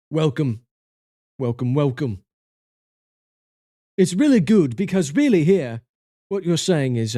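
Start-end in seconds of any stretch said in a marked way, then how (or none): 0.1-2.2 a hint of a British host; British accent. Streamer welcomes raiders in a light mock British accent. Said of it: "we're doing British things".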